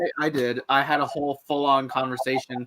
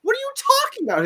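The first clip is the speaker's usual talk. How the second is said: baffled. Retold or imitated. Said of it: "I'm like".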